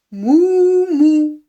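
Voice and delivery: two loud, held moos; loud double moo; cow moo. Shown as a demonstration.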